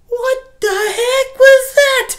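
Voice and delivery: high, loud, drawn-out disbelief; baffled outburst. Real, not acted. Reviewer reacting to the movie, loud baffled disbelief. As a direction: demand an answer, stunned. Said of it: "sitting here in the movie theater".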